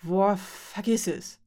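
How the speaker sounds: slow, exasperated dismissal; mild exclamation, fed up. A narrator's reading. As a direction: brush them off with a drawn-out groaning start. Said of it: "rief Fee auf einmal etwas lauter".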